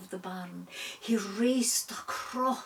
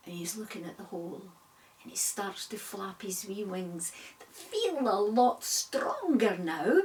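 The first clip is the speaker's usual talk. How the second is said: ordinary delivery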